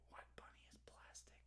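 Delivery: whispered puzzled aside. Real, not acted. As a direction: whisper it to yourself. Puzzling over chat aside.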